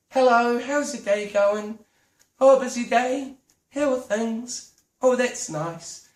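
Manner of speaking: slow, polite chatty customer voice; friendly small talk. Shown as a demonstration. Customer making small talk with shop staff, friendly. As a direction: chat politely, easing down at the end. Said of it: "I was talking like this".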